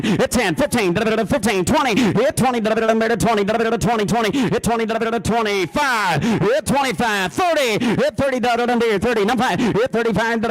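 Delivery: fast, high, loud auction chant with filler syllables; auctioneer. Shown as a demonstration. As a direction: rattle off the bids like a live auctioneer. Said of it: "the Panfish and Trout Fishing Kit".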